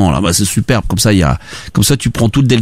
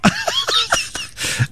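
shrieking burst of laughter; high squealing laugh; 9 dB quieter than usual. Real, not acted. (éclat de rire aigu)

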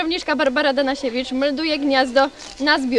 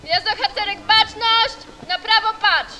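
drill sergeant; 6 semitones higher, 3 dB quieter than usual. Real, not acted. Troop leader calling commands.

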